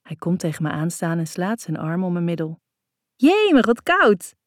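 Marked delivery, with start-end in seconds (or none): 3.1-4.3 high, loud yelp at the cold; startled high-pitched cry; calling out. A narrator's reading. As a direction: exclaim it in chilly shock. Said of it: "roep ik".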